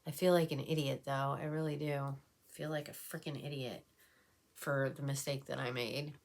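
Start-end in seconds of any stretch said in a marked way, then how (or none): none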